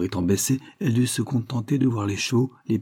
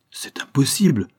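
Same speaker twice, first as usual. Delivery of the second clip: higher-pitched cry of disbelief; disbelieving exclamation; 6 semitones higher, 4 dB louder than usual. A narrator's reading. Young woman reacts to her father's news, exclaiming in disbelief. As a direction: exclaim it in mild shock, voice lifted. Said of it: "s'écria la jeune fille".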